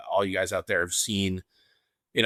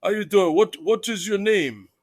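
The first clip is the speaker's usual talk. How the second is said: Iron Sheik voice; 10 semitones higher, 4 dB louder than usual. Retold or imitated. Sheik greeting a fan at signing, Iranian accent. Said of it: "in his Sheik voice".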